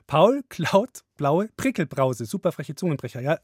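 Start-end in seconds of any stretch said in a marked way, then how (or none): 0.1-2.2 slow careful Paul klaut blaue Prickelbrause. Real, not acted. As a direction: say the tongue twister carefully. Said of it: "total verbockt".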